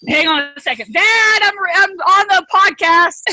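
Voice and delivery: calling out loudly to someone off-mic; hand over the phone. Real, not acted. Calling out to her dad.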